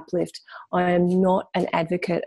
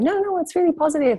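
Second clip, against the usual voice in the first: much higher-pitched imitation of insistent friends; mock upbeat reassurance; 10 semitones higher, faster than usual. Friends insisting to her that it's positive, mock upbeat reassurance. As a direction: chirp it high, imitating cheerleading others.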